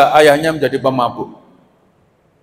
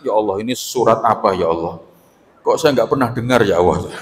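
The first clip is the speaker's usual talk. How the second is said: a low, sighing plea to God; lightly done complaining worshipper; weary moaning; 6 semitones lower than usual. Retold or imitated. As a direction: groan through it like a bored worshipper. Worshipper moaning at long recitation.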